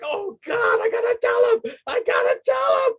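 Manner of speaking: frantic shout. Retold or imitated. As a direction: retell a panicked rush to the phone. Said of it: "I was like".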